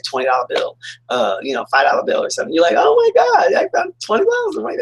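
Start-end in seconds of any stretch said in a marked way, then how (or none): 2.8-4.8 imitated excitement. Finding a twenty in an old pocket, excited high squeal. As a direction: squeal it like a lucky find. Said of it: "and you get so excited".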